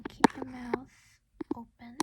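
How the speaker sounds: ordinary talk, nothing marked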